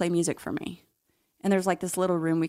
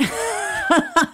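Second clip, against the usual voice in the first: high, loud burst of laughter at the joke; 5 dB louder than usual. Real, not acted. Joke about being an inmate.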